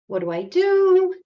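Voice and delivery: high, loud panicked cry; mock panic. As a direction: act out a sentry starting to panic. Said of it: "starts kind of panicking".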